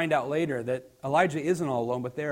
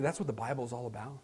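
ordinary delivery

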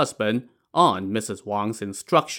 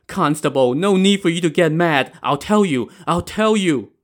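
anxious, raised placating voice; pleading; 6 semitones higher, 3 dB louder than usual. Acted. Cornered old woman placates constable, pleading. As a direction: beg to calm someone, pitch lifting. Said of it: "Missus Wang pleaded".

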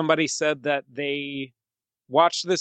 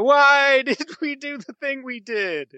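anguished; 9 semitones higher, 4 dB louder than usual. Retold or imitated. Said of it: "then go".

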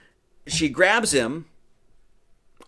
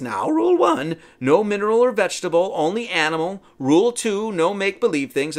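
higher, louder bossy witch voice laying down rules; Mad Madam Mim; 5 semitones higher, 3 dB louder than usual. Retold or imitated. Mim dictates the duel rules to Merlin, bossy witch voice. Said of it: "She says".

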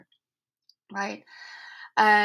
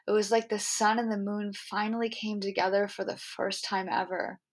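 ordinary talk

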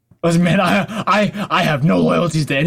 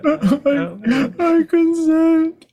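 high, drawn-out whimper; hammed-up crying surrender, stretched very slowly; mock tearful; 11 semitones higher, much slower than usual. Cornered prisoner gives forced consent, mock crying.

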